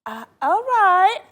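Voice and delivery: high, slow, wavering okay; mock shock. Real, not acted. Host pretending to be shocked by the refund promise, high and wavering. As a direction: pretend to be taken aback.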